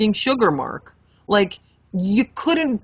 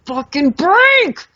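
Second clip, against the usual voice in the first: loud, high, drawn-out furious yell; frustrated yell; 10 semitones higher, 7 dB louder, much slower than usual. Retold or imitated. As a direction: yell it at the stubborn sugar. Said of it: "now I'm getting pissed".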